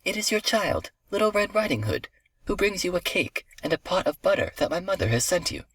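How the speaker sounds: put-on high-pitched cheerful little girl. A narrator's reading. Little girl answers at grandmother's door, high cheerful voice. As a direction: chirp it as an innocent child.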